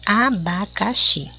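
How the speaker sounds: "abacaxi" slow, split a-ba-ca-xi; syllable by syllable. Shown as a demonstration. Teacher correcting the syllable exercise, syllable by syllable. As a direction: give each syllable of "abacaxi" its own beat. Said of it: "ó, como eu separei".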